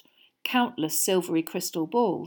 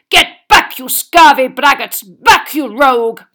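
loud, higher furious knight shout; angry knight; 6 semitones higher, 16 dB louder than usual. A narrator's reading. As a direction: bark it like an outraged knight.

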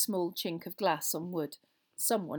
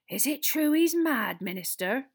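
Madame Rosmerta, anxious; 8 semitones higher, 8 dB louder than usual. Acted. Landlady asks minister about prisoner's sanity, wonderingly. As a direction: ask it with hushed curiosity.

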